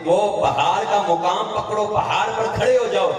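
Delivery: high-pitched retold call to a companion. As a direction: call an order across a distance, without shouting. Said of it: "ایک صحابی کو آواز دے کر". (دور سے پکارتے ہوئے، اونچے سُر میں)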